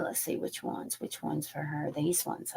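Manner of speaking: half-whispered to herself; soft, unvoiced search; murmured. Real, not acted. Choosing cards, murmuring.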